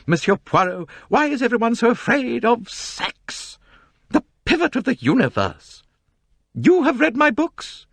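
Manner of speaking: high, complaining society novelist. A narrator's reading. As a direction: complain grandly about the crowd. Mrs Otterbourne declaims emphatically.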